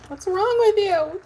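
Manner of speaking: high, loud incredulous jab; mock exasperated viewer. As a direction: ham up a baffled critic. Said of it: "For those who probably be like".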